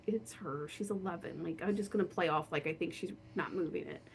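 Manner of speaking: inner thought. Retold or imitated. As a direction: say it as a hushed thought. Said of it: "but I'm like".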